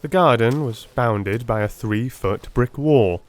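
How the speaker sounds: ordinary delivery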